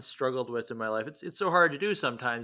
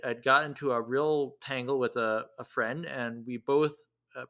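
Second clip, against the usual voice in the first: ordinary talk, nothing marked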